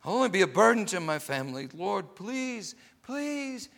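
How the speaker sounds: high, loud, wailing prayer to be allowed to die; desperate crying plea. Retold or imitated. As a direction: cry out to God in anguish, pushing to a shout. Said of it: "He cried".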